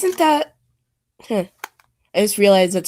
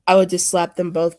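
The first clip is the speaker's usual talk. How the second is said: ordinary talk, nothing marked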